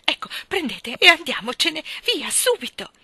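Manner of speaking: high, loud, urgent orders; frantic urging. A narrator's reading. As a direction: hurry the driver off in a panic. Frantic woman urging driver to leave. (fretta frenetica, voce acuta e forte)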